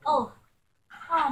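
normal talking voice

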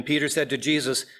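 usual speaking voice

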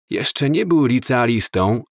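ordinary delivery, nothing marked

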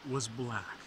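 dramatic legend narration; myth teller. A narrator's reading. Myth retold, higher and louder.

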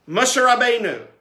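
usual speaking voice

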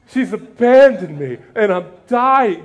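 over-the-top martyr wail; melodramatic wail. Acted. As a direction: ham up a dying complaint. Hammed-up Martha melodrama.

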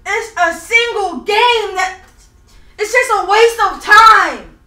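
loud, slow, wailed complaint; crying. Real, not acted. Gamer mourning the lost PlayStation, crying loudly. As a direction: break off and restart through sobs. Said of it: "So why you crying?".

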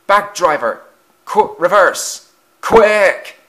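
loud, high panicked commands; urgent shouting. A narrator's reading. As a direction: shout to the driver, rising to a yell. Runaway trucks, engine calls to driver.

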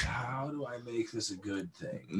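slow, soft musing in imitation; Fawn. Co-host imitating the artist switching into artist mode, musing. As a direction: mimic an artist pondering, slowly and quietly. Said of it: "switches into artist mode and goes".

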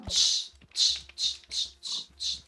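repeated short tss sounds; shush-click at an animal in quick bursts; sharp voiceless tss-tss; tss animal call. Real, not acted.

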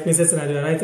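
ordinary talk

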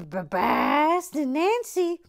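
high, slow, hammed-up Boston drawl on Nancy; a bad Boston impression, stretched out; Boston accent. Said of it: "I portray a".

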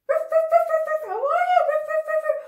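puppet dog voice. Pretend. Teacher voicing the puppet dog Jump Up for the kids, high loud barking. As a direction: bark it out as an excited little dog. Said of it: "Come out, Jump Up".

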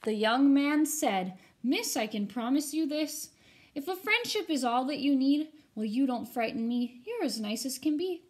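put-on lively rhyming read-aloud for kids; storybook reading. A narrator's reading. Teacher reading a rhyming ghost story to her students, animated. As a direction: read it to the class with bounce and drama.

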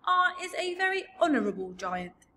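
high, loud, dignified giant; puffed-up giant pride; proud. A narrator's reading. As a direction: declare it with wounded honour. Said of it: "raised his great head proudly".

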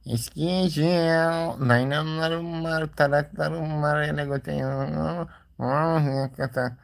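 high, slow peddler's sing-song call; melodic street vendor shout. Pretend. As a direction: cry your wares down the street like a junk dealer. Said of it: "hurda toplamaya giderim". (yüksek sesle, ağır ağır eskici çağrısı)

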